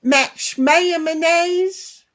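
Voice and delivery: slow, stretched Southern drawl on Max Mayonnaise; slurred Southern drawl, Mayonnaise stretched. Shown as a demonstration. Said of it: "it's got a Southern drawl to it".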